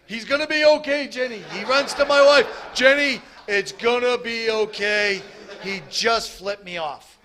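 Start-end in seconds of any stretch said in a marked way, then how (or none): 0.0-5.2 very high, loud joyful shouting; elated brother. Retold or imitated. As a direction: yell it with overjoyed relief to his wife. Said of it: "he freaks out. He starts clapping".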